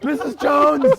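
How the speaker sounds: high panicked cry for teacher; panicked shout. Retold or imitated. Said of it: "He was like".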